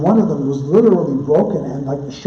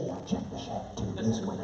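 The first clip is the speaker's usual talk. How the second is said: unvoiced, much softer joking aside; a quick whispered tip to the crowd; 5 semitones lower, 15 dB quieter than usual. Real, not acted. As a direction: whisper it to the room as a joke.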